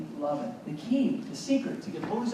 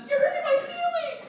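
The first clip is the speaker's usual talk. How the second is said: very high mock-wounded squeal; hurt victim whining. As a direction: whine it like a wounded little victim. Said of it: "You might tell them".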